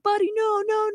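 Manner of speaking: high pleading cry of no, no; alarmed crowd plea. Retold or imitated. Retelling the crowd begging Buddy to stay, high and alarmed. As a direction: call out high to stop the dog.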